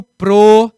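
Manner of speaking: pro with a closed o, /pɾo/. Shown as a demonstration. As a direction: keep the o in pro tight and closed. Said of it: "diferentemente de pró".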